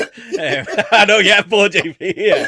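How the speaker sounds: laughing loudly through the words. Real, not acted. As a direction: giggle it out, amused at his friend. Joking about JP's fortieth birthday.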